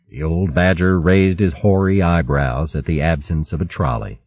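ordinary delivery, nothing marked

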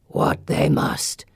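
slow, gravelly gargoyle rasp; gargoyle's terse answer. A narrator's reading. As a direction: answer slowly, like stone speaking.

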